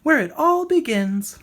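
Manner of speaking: high, wide-eyed bird voice; marveling bird. A narrator's reading. The bird marveling at her banner, high and awed. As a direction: marvel at the alphabet like a delighted songbird. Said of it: "she marveled".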